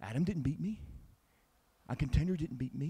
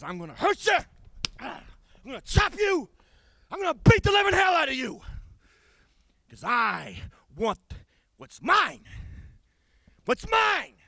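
high, loud, fast scream; screaming threats; 13 semitones higher, 7 dB louder than usual. Acted. Wrestler screaming threats at his rival. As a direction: shriek the threats wildly.

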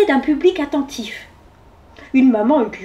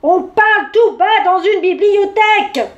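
loud, much higher outburst; exploding; 8 semitones higher, 5 dB louder than usual. A narrator's reading. Bear hushing chatty mouse, exploding in exasperation. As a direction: burst out at full indignant volume. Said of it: "explosa l'ours".

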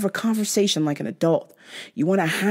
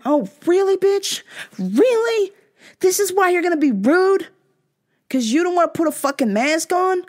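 indignant; 10 semitones higher than usual. Retold or imitated. Mock indignant reply to customer.

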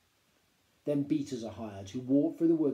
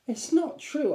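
disbelief; 8 dB louder, slower than usual. A narrator's reading. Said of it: "starting to laugh".

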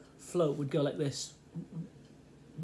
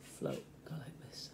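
hushed whisper; 3 semitones lower, 12 dB quieter, slower than usual. Retold or imitated. Hushed while watching the float.

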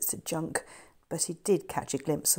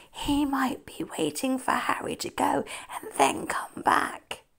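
higher whisper, warning; urgent whisper; 8 semitones higher than usual. Acted. George to Sandy, holding her ankle, urgent whisper. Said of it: "George hissed".

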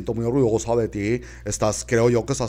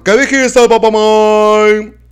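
shocked question; 9 semitones higher, 11 dB louder, slower than usual. Acted. Child shocked.

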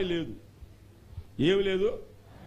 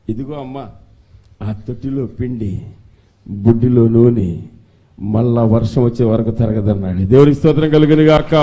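full-force preaching; 7 semitones lower, 15 dB louder than usual. Real, not acted. Pastor exhorting church to clap, loud preaching. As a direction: thunder it to the congregation.

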